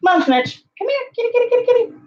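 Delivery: very high kitty call; calling the cat. Real, not acted. Owner coaxing her cat from the closet, very high.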